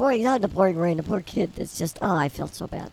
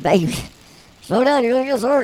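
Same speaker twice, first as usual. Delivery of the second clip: pleading shout; 5 semitones higher, 6 dB louder than usual. Retold or imitated. Wife begging husband at the wheel, pleading shout. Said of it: "I said".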